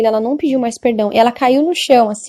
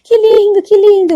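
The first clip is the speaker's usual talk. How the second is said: put-on high, delighted old woman's cries, louder on que lindo; joyful old lady; 10 semitones higher, 4 dB louder than usual. Elderly woman in line, thrilled before the angel, high and joyful. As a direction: gush with joy like a tiny elderly lady. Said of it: "e ela toda feliz".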